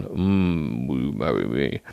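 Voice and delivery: mock romantic proposal; deep mock suitor. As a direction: drop the voice low like a smooth suitor. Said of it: "the partner would have gone".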